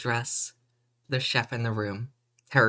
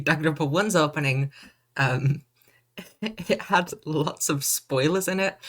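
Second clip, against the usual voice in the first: normal talking voice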